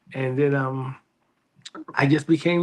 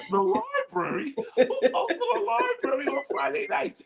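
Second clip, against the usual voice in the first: mocking disbelief. Teen mocking a friend's Friday library plan, high scornful disbelief. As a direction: scoff at the idea like it's a joke. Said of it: "And I was like".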